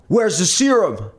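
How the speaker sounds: high, loud demand; security guard yells in the room; guard shouting. A narrator's reading. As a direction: bark the question.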